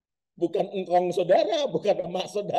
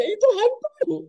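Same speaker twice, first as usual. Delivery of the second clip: screamed; slower than usual. Acted. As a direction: scream it in fright. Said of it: "mereka teriak semuanya".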